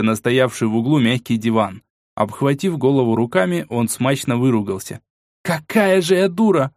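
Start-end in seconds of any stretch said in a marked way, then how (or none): 5.4-6.7 high, exasperated outburst; angry self-reproach. A narrator's reading. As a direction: berate yourself in a rage. Furious at herself, throwing chairs.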